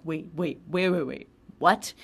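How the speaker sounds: rising alarm ending in a loud what; inner panic. Retold or imitated. Inner panic about stitches.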